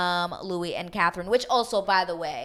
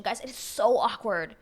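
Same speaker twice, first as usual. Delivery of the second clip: ordinary delivery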